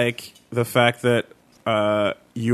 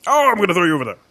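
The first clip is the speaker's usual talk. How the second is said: game character voice; 6 semitones higher, 3 dB louder, much faster than usual. Pretend. Game voice line imitation.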